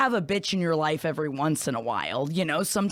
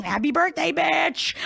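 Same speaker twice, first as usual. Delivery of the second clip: much higher, playful sing-song shout; excited sing-song; 9 semitones higher, slower than usual. Acted. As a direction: belt it out like a party cheer.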